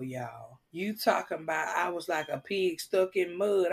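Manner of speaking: ordinary delivery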